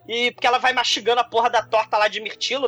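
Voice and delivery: ordinary delivery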